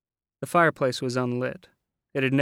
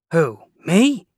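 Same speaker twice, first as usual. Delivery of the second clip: loud high startled squawk; 5 semitones higher, 12 dB louder than usual. Acted. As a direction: balk at the idea, alarmed. Landlady asked to check body.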